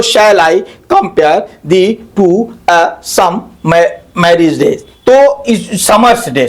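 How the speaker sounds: slow, detached syllable-by-syllable reading; split into syllables. Shown as a demonstration. Teacher showing students how the line divides, split into syllables. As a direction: break the line apart, a gap after each piece. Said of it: "इसको सब अलग-अलग बांट दिया".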